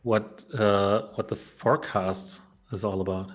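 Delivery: ordinary talk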